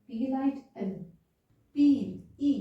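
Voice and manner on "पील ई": पील, ई louder, with a long ee; long vowel. Shown as a demonstration. Teacher repeating the sound for learners, loud long पील, ई. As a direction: push and lengthen the ee in पील, ई. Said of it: "ई की sound लंबी जा रही है".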